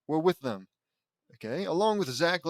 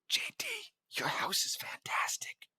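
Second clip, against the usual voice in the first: hushed, voiceless whisper; 7 dB quieter than usual. A narrator's reading. Varee whispers to JD admiring the house, whispering. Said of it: "whispers Varee".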